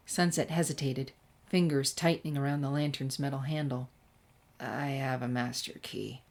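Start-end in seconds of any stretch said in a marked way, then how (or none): 4.6-6.2 reluctant admission. A narrator's reading. Sunset admitting reluctantly.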